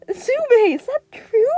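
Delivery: high loud bewildered outcry; bewildered cry. Acted. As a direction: cry out in astonishment. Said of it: "Ashido cried out, bewildered".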